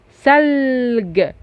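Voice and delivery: ثلج slowly with the middle L held long; middle L demonstration. Shown as a demonstration. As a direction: hold the L inside ثلج. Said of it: "نطقتها في نص الكلمة". (مد اللام في نص ثلج)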